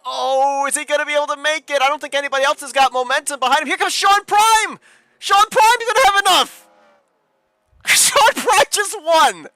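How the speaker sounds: high, loud race-finish call; excited. Real, not acted. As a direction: scream the finish line home. Final lap photo finish call.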